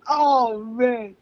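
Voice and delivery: normal talking voice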